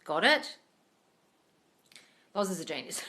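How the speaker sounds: normal talking voice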